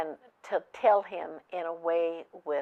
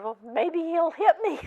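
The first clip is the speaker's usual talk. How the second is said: high, louder imitation of a worried wife; retold worry; 11 semitones higher, 7 dB louder than usual. Wife telling her counselor what she fears, retold worry. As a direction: voice her fear, pitched well up. Retelling wife's fear of husband.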